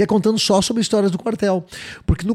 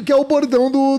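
normal talking voice

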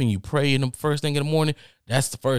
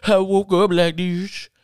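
high, drawn-out \"woke up like this\"; hammed-up flawless-morning brag; mock diva; 8 semitones higher, 5 dB louder than usual. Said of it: "That whole".

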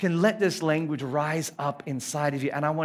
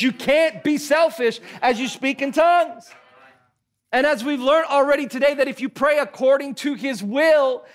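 raised, driving sermon delivery; impassioned preaching; 5 semitones higher, 4 dB louder than usual. Real, not acted. Preacher arguing for tongues to congregation, impassioned preaching. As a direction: preach it with rising pitch and force.

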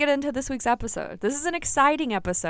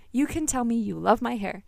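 ordinary talk, nothing marked

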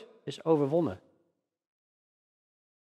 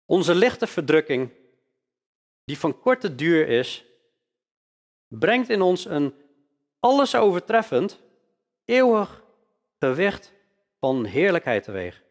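solemn scripture reading. Acted. Preacher reads scripture aloud.

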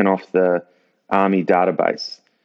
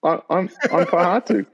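stammering, higher-pitched bewilderment; halting, lost and unsure; confused; 9 semitones higher than usual. Retold or imitated. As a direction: imitate a baffled trainee, half laughing. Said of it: "he doesn't know what's going on".

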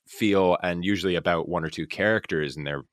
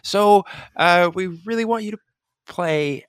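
hesitant director; 11 semitones higher, 5 dB louder than usual. Retold or imitated. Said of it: "she was like".

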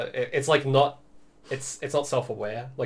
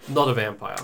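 flat sarcastic aside; deadpan sarcasm; 2 semitones lower, 4 dB louder than usual. Acted. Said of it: "I'm saying it sarcastically the entire time".

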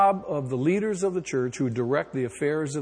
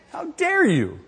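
mock outrage; 10 semitones higher, 3 dB louder, slower than usual.

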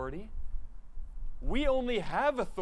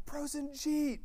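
much higher call of a name across the street; 11 semitones higher, 4 dB quieter, slower than usual. Retold or imitated. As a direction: hail a friend from down the block. Calling friend across the street.

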